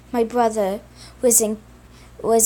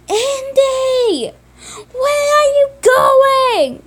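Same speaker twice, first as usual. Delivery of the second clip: high, loud crying yell, drawn out slowly; 14 semitones higher, 7 dB louder, much slower than usual. Acted. Simon yelling as his brother is taken away, high crying shout. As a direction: scream after him as he's taken. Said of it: "He started yelling".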